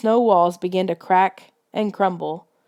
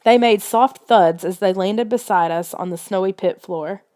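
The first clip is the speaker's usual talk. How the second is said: ordinary delivery, nothing marked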